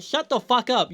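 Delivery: high-pitched fed-up snap at Ellie; exasperated retort. Real, not acted. Reviewer firing back at Ellie, exasperated. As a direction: tell her to shut up, fed up. Ranting at Ellie's hissy fit.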